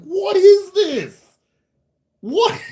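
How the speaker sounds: high, loud and slow disbelief; incredulous. Real, not acted. Reacting to AI picture.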